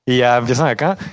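ordinary talk, nothing marked

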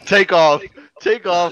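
loud, high cheer; pumping up a friend to rant; hype shout. Real, not acted. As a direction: fire him up like a launch call. Cheering the green light.

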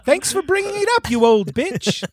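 high, laughing jab; mock thanks through laughter; laughing sarcasm. Sarcastic insult while laughing.

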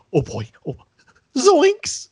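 squeaky panicked nerd, with "zoinks" yelped high; a nerd who completely loses it; mock-panicked geek yelp; flustered Screech. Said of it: "Screech might run out".

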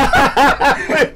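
high loud burst of laughter; cracking up loudly. Real, not acted.

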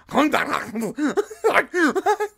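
loud, high guttural outburst; angry-sounding German caricature noises; mock German gibberish. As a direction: bark harsh fake German nonsense. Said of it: "she chose German as a sexy accent".

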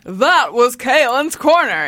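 slow, drawn-out announcer sign-off; hammed-up smooth host voice; podcast voice. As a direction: stretch it like a silky show announcer. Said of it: "say in podcast voice".

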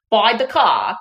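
a loud, hammed-up Moira Rose; Moira Rose impression. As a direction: ham up a grand lady's line. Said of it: "You sounded like Moira Rose".

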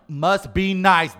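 loud slow gasping; mock jealous gasp. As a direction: gasp it out, hammy.

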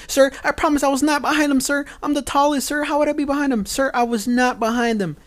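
high, loud, panicked recruit begging; panicky trainee voice. Retold or imitated. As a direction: plead like a terrified recruit who can't stop saying sir. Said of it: "he talks just like that".

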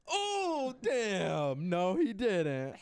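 very loud, high, drawn-out mock shock; hammed-up shouted disbelief, pitch sliding down; imitated crowd reaction. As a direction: whoop it slowly like a scandalized crowd. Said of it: "Everyone's like".

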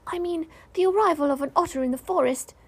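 high little creature reasoning aloud; earnest small animal musing; talking to himself. A narrator's reading. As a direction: think it through in a small high voice. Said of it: "he said to himself".

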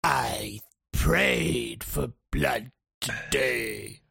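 very slow, low orc proclamation; bloodthirsty orc. Acted. Orc enemy holding up the fight with a speech, hammed-up bloodthirsty drawl. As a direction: intone it like a monstrous warlord, dragging each word. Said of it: "it's all like".

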